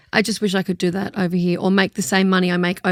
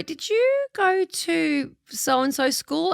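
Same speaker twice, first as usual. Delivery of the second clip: higher, very slow quizzing; probing stranger; 6 semitones higher, 4 dB quieter, much slower than usual. Retold or imitated. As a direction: drag out the guess like a nosy stranger. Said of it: "they go".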